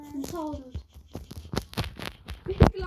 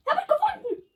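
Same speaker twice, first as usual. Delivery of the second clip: excited high yell with a laugh; yelling across the room; 10 semitones higher, 3 dB louder than usual. Real, not acted. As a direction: shout the find out, laughing. Kid finds item in game.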